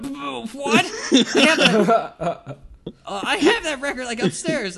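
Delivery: high, loud, laughing stammer; stammering excitement. Retold or imitated. Telling friends about the keyboardist, excited laughing splutter. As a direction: splutter it out, half laughing in disbelief. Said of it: "I'm like".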